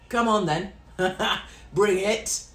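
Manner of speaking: loud, cocky challenge; ready for a fight. Shown as a demonstration. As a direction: square up and dare them. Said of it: "when I say do a Katie".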